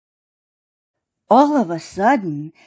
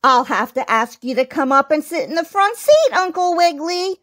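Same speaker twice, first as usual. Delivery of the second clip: high, quick mouse-teacher voice; little mouse schoolmistress, mildly sad; sad squeak; 7 semitones higher, faster than usual. A narrator's reading. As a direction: squeak out a regretful order. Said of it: "squeaked the Lady Mouse teacher sadly".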